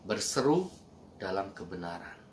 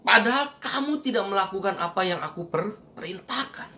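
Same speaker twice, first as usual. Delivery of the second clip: fervent sermon rebuke, much higher; preaching rebuke; 7 semitones higher than usual. Acted. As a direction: drive home "Padahal" as a rebuke. Preacher delivering Jesus's rebuke.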